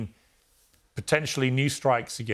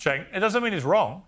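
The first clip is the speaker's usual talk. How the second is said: interjecting; 6 semitones higher than usual. Real, not acted. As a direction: break in firmly over the other speaker. Host cuts in over guest.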